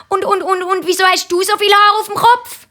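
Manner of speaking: sehr hohes, drängendes Kind; Kinderstimme. A narrator's reading. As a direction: hak stotternd und hoch nach. Kind fragt die Mami weiter. (sehr hochi Chinderstimm)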